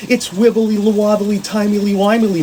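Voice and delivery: high, loud singsong silliness; wibbly wobbly riff. Pretend. Ranting to TikTok viewers about Doctor Who, high giddy singsong. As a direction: bounce up high and play with the nonsense words.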